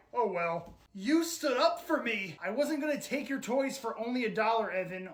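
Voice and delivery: ordinary talk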